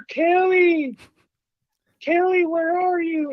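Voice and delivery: high, drawn-out ghostly call; eerie sing-song calling of a name; spooky name call. Retold or imitated. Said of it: "that typical spooky, name calling thing".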